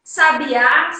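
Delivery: Sabiá stressed on the final Á; stress on last syllable. Shown as a demonstration. Teacher contrasting three look-alike words, Sabiá stressed on the final á. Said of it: "É a marcação de sílaba tônica".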